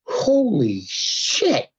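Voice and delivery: very slow, drawn-out amazement; stretched-out stunned reaction; awestruck disbelief. Retold or imitated. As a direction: let each word hang in retold shock. His retold awe at the news.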